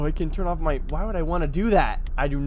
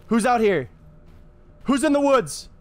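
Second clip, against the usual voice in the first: loud, higher call into the woods; 8 semitones higher, 4 dB louder than usual. Real, not acted. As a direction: shout out into the dark. Calling out into dark woods.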